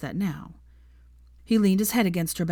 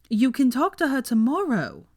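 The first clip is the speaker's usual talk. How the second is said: exasperated; 7 semitones higher, 4 dB louder than usual. A narrator's reading. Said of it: "said Theo exasperatedly".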